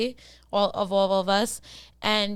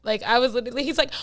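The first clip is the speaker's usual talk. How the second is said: ordinary delivery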